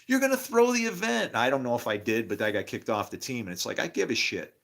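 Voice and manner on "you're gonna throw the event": coach yelling. Retold or imitated. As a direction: mimic the coach's outraged challenge. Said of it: "the coach comes up".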